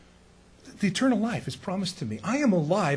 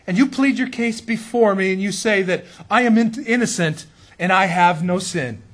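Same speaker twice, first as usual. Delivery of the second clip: a pleading sinner's voice, higher and louder; 4 semitones higher, 6 dB louder than usual. Retold or imitated. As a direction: plead your innocence before God, slowing at the end. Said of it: "he said".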